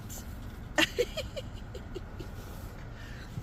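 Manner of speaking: long, high, breathy giggle; tickled, squeaky laughter. Real, not acted. Laughing at the animals.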